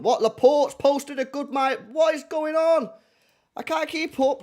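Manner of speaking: high, loud startled outburst; can't believe the news, voice shooting up; shocked disbelief. Real, not acted. Shocked reaction to transfer news.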